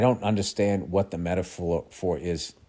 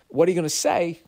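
higher, a lightly voiced Walken threat; bad Walken menace; 8 semitones higher than usual. Walken's killer asking the kid, lightly imitated. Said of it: "I'm gonna do a really bad Christopher Walken".

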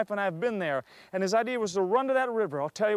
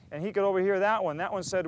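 normal talking voice

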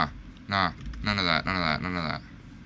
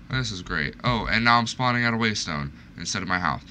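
ordinary talk, nothing marked

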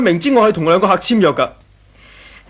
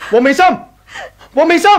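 calling out; 7 semitones higher than usual. Acted. Husband calling out angrily.

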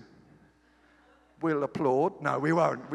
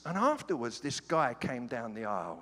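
normal talking voice

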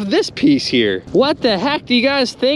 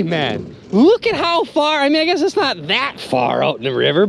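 usual speaking voice

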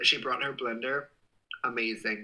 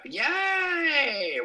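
high loud yay; cheering; 13 semitones higher, 6 dB louder than usual. Real, not acted. Streamer cheering at chat comments, high loud yay.

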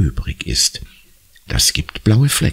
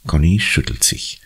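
usual speaking voice

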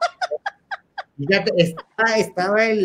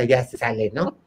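ordinary delivery

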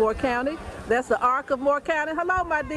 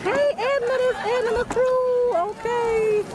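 high, slow call to a friend; 10 semitones higher, 3 dB louder than usual. Real, not acted. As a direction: sing out her name across the crowd. Greeting friend passing in march.

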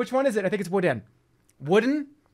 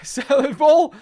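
real excited shriek, louder and higher; excited scream; 7 semitones higher, 8 dB louder, slower than usual. Real, not acted. Streamer finding a wooden salad bowl, screaming with delight. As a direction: yell with delight at the find. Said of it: "I'm sorry, I just screamed".

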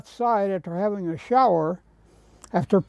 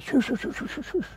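high, soft shushing, fading to breath; hushing shoo; 7 semitones higher, 8 dB quieter, faster than usual. Retold or imitated. Curator hushing the artist on the scaffold, soft and high. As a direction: hush him so the people below won't hear.

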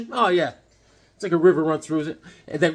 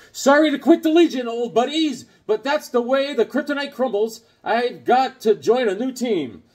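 higher, louder breezy hero goodbye, slowing at the end; hammed-up Superboy farewell; 7 semitones higher, 5 dB louder than usual. Superboy saying goodbye to his Legion teammates, mock-heroic. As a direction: play the cocky teen hero bowing out.

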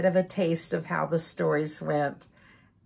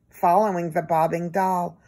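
ordinary delivery

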